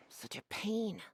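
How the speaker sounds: whispered grumble under the breath; irritated aside whispered to no one; annoyed whisper. Acted. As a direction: mutter it to yourself, fed up. Annoyed librarian grumbling to herself.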